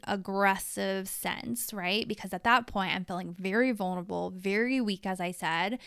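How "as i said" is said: ordinary delivery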